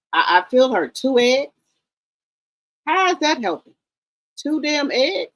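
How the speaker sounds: high, incredulous questioning; indignant disbelief. Real, not acted. Indignant at the fighter's meagre food.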